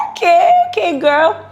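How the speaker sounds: high, loud mock-impressed cheer; impressed reaction. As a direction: act out being wowed by a friend. Retold admiring reaction to friend dancing.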